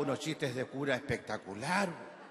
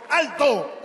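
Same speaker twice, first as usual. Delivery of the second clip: high, loud halt order; short, forceful shout to stop; policeman's command; 9 semitones higher, 11 dB louder than usual. Acted. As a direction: bark it like a traffic cop. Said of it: "dijo el carabinero".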